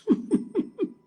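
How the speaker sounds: breathy high-pitched giggle; high unvoiced giggle. Real, not acted. Voice actor giggles after praise for his acting. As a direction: let out a squeaky laugh.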